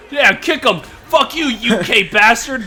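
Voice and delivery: loud, slow, jeering yell; jeering mock battle cry; rowdy shout. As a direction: cheer the mob on. Egging the villains on.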